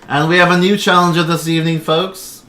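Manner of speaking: loud showman build-up; arena announcer. Acted. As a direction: hype the newcomer. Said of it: "And he goes".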